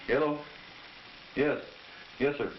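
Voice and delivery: usual speaking voice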